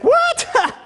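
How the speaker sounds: high-pitched, incredulous yelp; hammed-up astonishment; shocked outburst. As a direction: act out stunned disbelief with a leaping pitch. Prince shocked at being told he's adopted.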